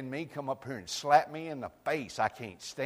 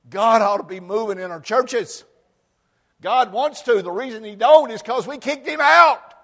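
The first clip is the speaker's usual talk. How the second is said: high, loud shouting climax; preaching shout; 9 semitones higher, 16 dB louder than usual. Acted. Pastor shouting at the congregation that they kicked God out. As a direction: shout it to the back pew.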